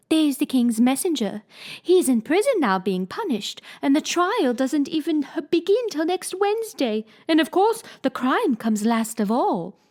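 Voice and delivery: lightly voiced queen, insisting. Queen to Alice, lightly voiced fussy queen.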